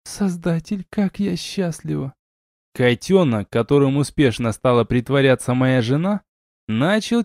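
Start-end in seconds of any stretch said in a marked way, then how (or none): 0.0-2.1 high, hushed woman's voice; blissful wife. A narrator's reading. Happy wife in husband's arms, blissful murmur. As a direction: breathe out happiness in a female voice.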